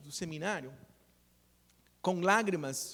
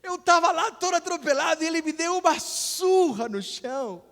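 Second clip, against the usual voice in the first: high, loud comic protest; mock outrage; 11 semitones higher, 9 dB louder than usual. Friend relives father's beating after accident, loud mock outrage. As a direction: belt out the disbelief for laughs.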